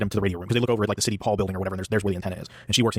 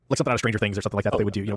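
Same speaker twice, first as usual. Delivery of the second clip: ordinary talk, nothing marked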